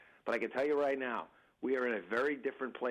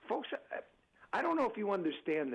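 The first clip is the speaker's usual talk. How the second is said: ordinary delivery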